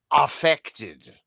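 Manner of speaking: Hans Conried. Retold or imitated. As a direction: deliver the punchline in a mock-grand actor accent. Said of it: "What is that accent?".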